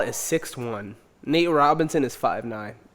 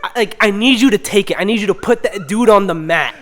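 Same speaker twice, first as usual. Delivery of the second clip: fast, getting louder and higher; excited building to shouting; 6 semitones higher, 6 dB louder than usual. Real, not acted. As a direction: pump it up to a yell. Hyped wanting the knockout.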